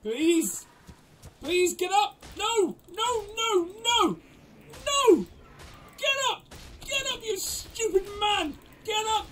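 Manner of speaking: high, pleading cries to get up; desperate pleading. Real, not acted. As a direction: beg him frantically to stand. Begging knocked-out dino to wake.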